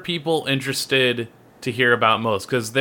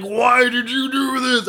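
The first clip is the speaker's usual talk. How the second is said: high whiny; 11 semitones higher than usual. Retold or imitated. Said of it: "they'd be like".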